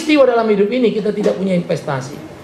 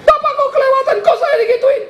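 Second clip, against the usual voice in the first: imitating angry crybaby. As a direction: mimic someone scolding you through tears. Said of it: "dimarahin orang". (meniru orang cengeng yang marah)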